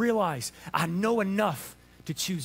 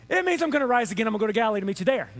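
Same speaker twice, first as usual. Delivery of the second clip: mock exasperation; 4 dB louder, much faster than usual. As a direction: spell it out fast, out of patience. Exasperated Jesus spelling it out again.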